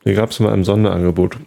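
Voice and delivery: talking with a mouth full of bread; chewing between words, mouth full. Real, not acted. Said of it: "mit vollem Mund podcasten". (mit vollem Mund)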